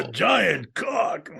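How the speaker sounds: high, drawn-out cartoon shout; cartoon bluster. Capping co-host's cartoon joke, blustery cartoon voice. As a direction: stretch it slowly into a high cartoon holler.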